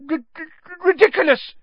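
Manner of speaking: very high, fast, loud squeak; stutter, then squeak the word out; stammering squeak. A narrator's reading. Nervous pupil casting at the boggart, stammering high squeak. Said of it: "squeaked Neville".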